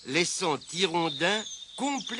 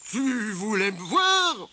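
slow, higher, louder character voice; grumpy mole; 5 semitones higher, 5 dB louder, slower than usual. A narrator's reading. Mole asks Tirondin why he came, grumpy. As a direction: drag the question out as a testy mole.

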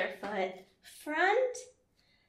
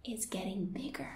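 fast, much softer whisper; 5 semitones lower, 10 dB quieter, faster than usual. Acted. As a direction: breathe it out quickly. Whispered growing story.

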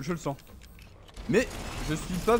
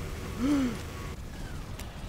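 startled gasp; 10 semitones higher, 7 dB quieter than usual. Real, not acted. Narrow escape from boss.

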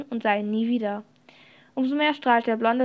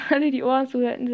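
plain reading voice

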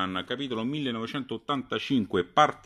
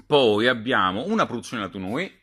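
ordinary delivery

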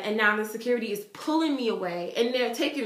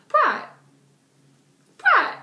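higher, louder calling of his name; 8 dB louder than usual. Retold or imitated. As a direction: call him to get his attention. Calling a silent boy.

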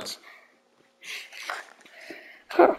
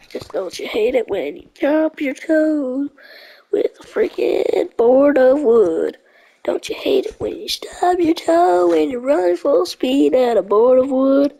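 slow, drawn-out, higher-pitched wail; mock lament; 4 semitones higher, 3 dB louder, slower than usual. Gamer moaning about a stubbed toe, mock-dramatic drawl. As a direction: build into a loud, hammed-up complaint.

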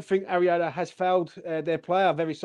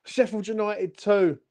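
ordinary delivery

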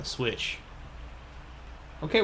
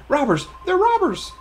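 high, loud cry of alarm; alarmed captive; 14 semitones higher, 5 dB louder than usual. Acted. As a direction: call out the crooks in a high voice. Captive calls out for help.

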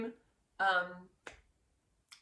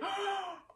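gasp; 11 semitones higher than usual. Retold or imitated. Reliving winning the crown.

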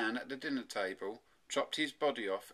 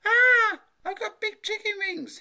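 put-on high, loud boyish scream; scream, then wail in high-pitched shock; horrified cry; 15 dB louder than usual. A narrator's reading. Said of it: "he cried".

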